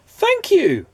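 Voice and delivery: bright high thanks from a woman; high woman's voice. A narrator's reading. A woman thanking Mister Good, high voice. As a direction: voice the woman well above your own. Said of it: "said a woman".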